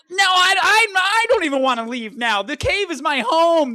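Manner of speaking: insisting the cave is home; hammed-up indignation; outburst.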